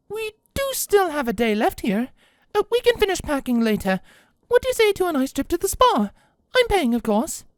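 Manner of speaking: high, bright offer; cheerful lady voice. Acted. Rarity offering the sisters a spa trip, high cheerful voice. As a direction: coax them into a treat.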